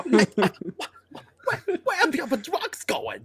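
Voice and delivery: high-pitched silly hero voice; Batman. Retold or imitated. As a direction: squeeze the question out very high. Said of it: "Batman trying to find his voice".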